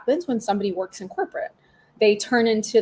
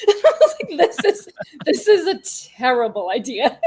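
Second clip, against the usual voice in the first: high, louder words broken by laughter; laughing through words; 6 semitones higher, 5 dB louder than usual. Real, not acted. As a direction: laugh at how silly it was while you say it. Laughing while retelling.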